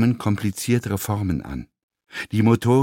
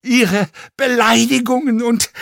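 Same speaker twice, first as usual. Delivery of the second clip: high, strained croaking protest; strange croak; 10 semitones higher, 4 dB louder than usual. Acted. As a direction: push the words out through a croak. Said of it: "nur als ein seltsames Krächzen".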